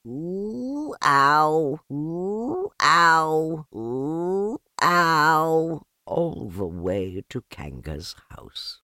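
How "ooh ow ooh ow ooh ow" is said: alternating high ooh and loud ow. A narrator's reading. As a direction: bounce between ooh and ow. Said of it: "he was saying".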